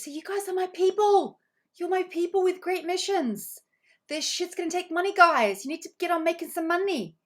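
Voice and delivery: high, loud, fired-up cheering; rally the group like a hype coach; excited rallying. Real, not acted. Coach cheering her webinar group about their missions, excited and loud.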